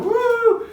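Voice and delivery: high, loud "woo"; whoop. Acted. Reader whooping over the quick zoom backwards, high whoop.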